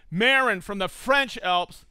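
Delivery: ordinary talk, nothing marked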